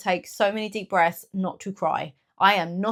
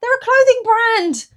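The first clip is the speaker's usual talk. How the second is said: much higher, louder and slow, fed up; exasperated correction; 9 dB louder, slower than usual. Real, not acted. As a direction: spell it out to a clueless husband. Exasperated at his mistake.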